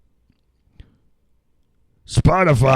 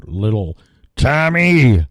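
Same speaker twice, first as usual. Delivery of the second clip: ordinary talk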